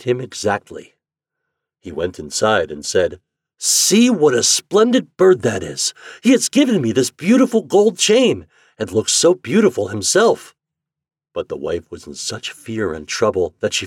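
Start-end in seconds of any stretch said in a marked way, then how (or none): 3.6-10.6 high, loud, swooping admiration; gleeful storybook enthusiasm; delighted man. Acted. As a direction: show off the gift with delight. Man delighted with gold chain.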